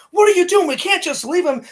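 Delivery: high, loud imitation of a protest; retold outraged protest. Spider protests to Bert in retold film, outraged. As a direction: argue it excitedly, pitched well up. Acted quarrel between film characters.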